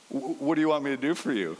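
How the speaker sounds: quick, lightly voiced parent humoring a child; teasing parent. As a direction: ask it like an amused parent who already knows. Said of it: "kinda like a parent going".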